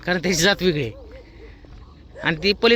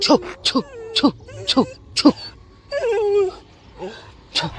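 shooing dogs; slower than usual. Real, not acted.

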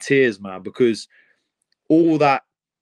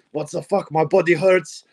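put-on dad grumbling in an Eastern European accent, pitched higher; tired father griping in a light Eastern European accent; 5 semitones higher than usual. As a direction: son imitates his dad in a mild Eastern European accent. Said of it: "in his Eastern European accent".

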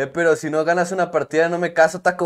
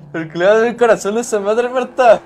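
laughing mock outrage; 7 semitones higher, 6 dB louder than usual. Mock jealous outburst, laughing.

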